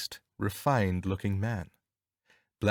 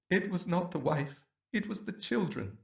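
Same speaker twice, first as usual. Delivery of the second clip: high, soft anguished groan; 8 semitones higher, 5 dB quieter than usual. A narrator's reading. Unmasked husband to Holmes, softly groaning in anguish. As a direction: let it break with grief. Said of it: "groaned the prisoner".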